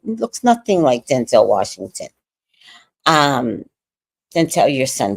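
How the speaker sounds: ordinary talk, nothing marked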